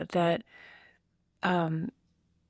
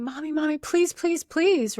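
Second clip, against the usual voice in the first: put-on high, pleading little-kid voice, louder on the pleases; pleading toddler; 9 semitones higher, 5 dB louder than usual. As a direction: beg like a two-year-old tugging a shirt. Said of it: "pulling on your shirt and saying".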